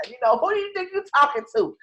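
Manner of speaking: fast, high, loud scolding; mock outraged mom; mother scolding. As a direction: snap it like an indignant mother.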